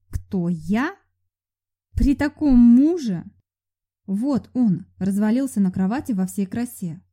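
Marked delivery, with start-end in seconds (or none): 0.1-3.2 mock disbelief.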